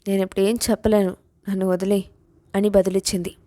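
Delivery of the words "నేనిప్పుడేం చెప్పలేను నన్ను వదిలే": ordinary delivery, nothing marked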